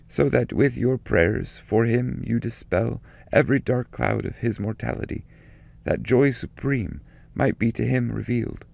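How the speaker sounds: solemn, fervent poetic prayer, held high throughout; prayer recitation. Acted. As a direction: lift the voice and pray each line to the Virgin. Recites Bernard's prayer from canto.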